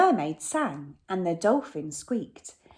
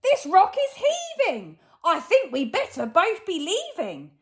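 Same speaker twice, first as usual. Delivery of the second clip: higher, louder, nervous robber Hugh; panicky robber; 12 semitones higher, 4 dB louder than usual. Acted. Hugh feels the whale heave and panics, high pitched. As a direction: fret like a scared crook. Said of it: "cried Hugh".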